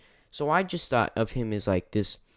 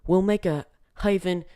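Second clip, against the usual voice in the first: a faintly put-on accent, barely there; 轻描淡写的蹩脚口音模仿; terrible Korg accent; 5 semitones higher than usual. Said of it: "excuse my terrible accent".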